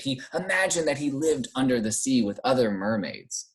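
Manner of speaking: ordinary delivery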